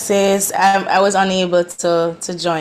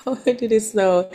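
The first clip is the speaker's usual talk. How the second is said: ordinary talk, nothing marked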